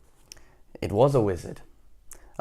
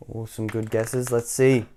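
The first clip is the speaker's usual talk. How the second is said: ordinary delivery, nothing marked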